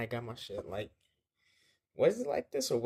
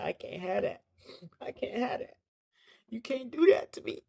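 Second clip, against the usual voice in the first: high, thin mock pleading; pleading protest; 13 semitones higher than usual. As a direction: protest in a high voice, fading into a whispery plea. Retold protest over threatened mask.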